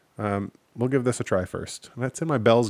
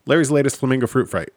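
said quickly; 3 semitones higher, 5 dB louder, faster than usual. Shown as a demonstration. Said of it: "I think that was right".

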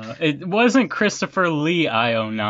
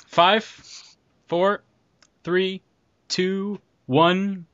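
countdown; 5 semitones higher, much slower than usual. Real, not acted. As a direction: call out each number. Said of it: "we're gonna start in".

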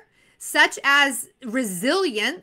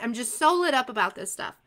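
ordinary talk, nothing marked

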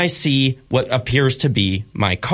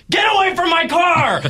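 put-on old lady yelling. Old lady runs at the stranger, shouting high. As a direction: chase him off with a high shrieking yell. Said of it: "She's like".

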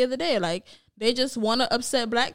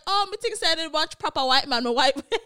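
laughing Jamaican patois, higher; 4 semitones higher than usual. Retold or imitated. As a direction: slip back into Jamaican patois. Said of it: "she was like".

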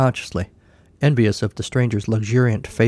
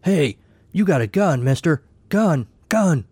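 a higher, urgent call of alarm, building on each Gun!; said loudly; 6 semitones higher than usual. A narrator's reading. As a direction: call it out across the bar as a warning. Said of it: "then said loudly".

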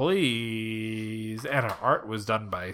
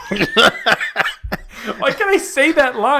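laughing through the words; giggling at his own flubbed line; 12 semitones higher, 7 dB louder, much slower than usual. Real, not acted. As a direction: laugh while asking.

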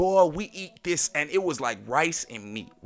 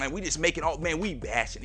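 ordinary delivery

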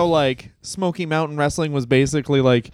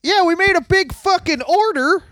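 Jim Cornette swearing tirade. Retold or imitated. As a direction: rage about the big order. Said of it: "hitting this impression".

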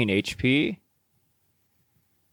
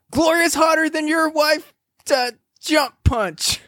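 much higher-pitched mock battle cry; mock yell; 13 semitones higher than usual. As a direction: call out the attack in a high, half-yelled voice. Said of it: "Jay's yelling something".

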